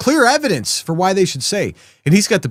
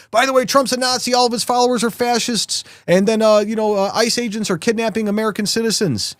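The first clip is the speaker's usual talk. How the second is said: higher, hammed-up mock politician voice; mocking governor; 6 semitones higher than usual. Host mocks the governor's double talk in a high, hammed-up voice. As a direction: sneer it like a hypocrite. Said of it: "Bring down rhetoric".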